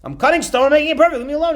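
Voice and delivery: high, loud, pestered worker; exasperated. Acted. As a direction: snap back at a nagging questioner. Same worker, pestered, snaps back.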